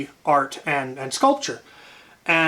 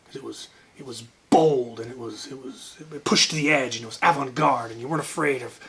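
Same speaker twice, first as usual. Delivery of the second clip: lower, mock-gushing art teacher; brother's teacher praising his Spam fetus, lower mock-gushing; imitating the teacher; 4 semitones lower, 4 dB quieter than usual. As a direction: play the teacher heaping praise, a bit lower.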